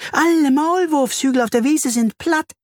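very high, excited bee voice. A narrator's reading. Bertha the bee reports flattened molehills, excited. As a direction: squeak out the alarming news. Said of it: "summt sie aufgeregt".

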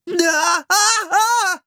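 hohe, erschrockene Schreie; plötzlich hochgerissen aufschreien; Schrei. A narrator's reading. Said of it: "schrie Philipp". (Philipp schreit in der Falle)

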